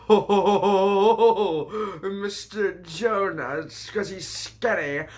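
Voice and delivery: slow, stretched, high goofy character voice; drawled mocking retake of the punchline; silly put-on voice. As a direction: ham it up like a cartoon sidekick.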